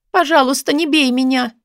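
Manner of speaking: high, pleading voice; frightened plea. Acted. Woman still afraid of being hit, frightened plea.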